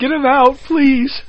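high, begging whine; mock pleading. Worn-out court begging to release podcasters, mock pleading. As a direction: beg to be rid of them.

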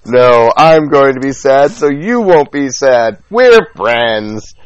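loud, high, stretched-out mocking rant; mock exasperation. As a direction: hammer it out slowly, loudly mimicking their exchange. Mocking the others' mutual sadness.

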